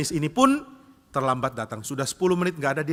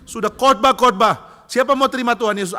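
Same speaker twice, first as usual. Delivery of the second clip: loud, high exasperated preaching; acted furious grumble at full volume; very angry; 9 semitones higher, 10 dB louder than usual. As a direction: vent like a fuming evangelist. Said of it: "Wah, marah sekali".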